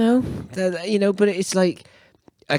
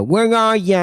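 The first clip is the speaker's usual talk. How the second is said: high, loud mock pop punk fake British accent; sing-shout it in a put-on British accent; 14 semitones higher, 5 dB louder than usual. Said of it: "[name] will come on and go".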